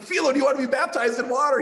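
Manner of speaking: high, loud and fast eager offer; excited. Retold or imitated. Pastor asking Philo about baptism, high, loud and eager. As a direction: burst out with the offer.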